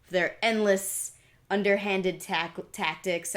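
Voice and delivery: usual speaking voice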